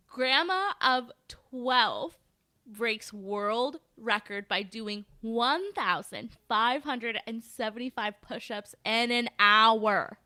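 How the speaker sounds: very slow headline, figures spelled out clearly; slow emphatic repeat. Shown as a demonstration. Repeating the headline for an astonished co-host, slow and emphatic. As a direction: draw out each phrase so the number lands. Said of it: "Wait, say that number again".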